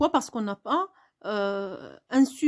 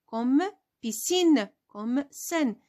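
1 slow example; much slower than usual. Shown as a demonstration. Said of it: "si on a E ou bien I".

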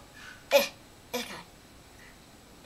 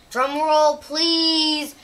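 loud, high drum roll call; loud announcement; 5 semitones higher, 4 dB louder, much slower than usual. Real, not acted. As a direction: announce it like a big reveal. Announcing a reveal.

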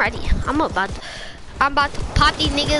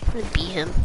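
deep mock voice; 9 semitones lower, 6 dB quieter, faster than usual. Odd low voice aside.

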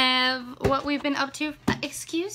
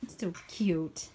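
soft whisper; 10 semitones lower, 10 dB quieter than usual. Real, not acted. Whispering beside sleeping babies.

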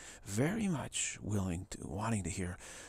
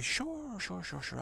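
high drawn-out first word, then quicker repeats; mock eager agreement; 9 semitones higher, slower than usual. Person humoring the goddess's request, mock eager. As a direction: agree over-readily, starting way up high.

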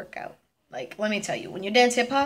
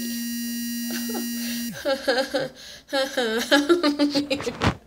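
long squeal breaking into giggles; laughing at oneself. Real, not acted. Vlogger laughing at her own horrible accent, long squealing laugh. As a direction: hold a squeal, then burst out laughing.

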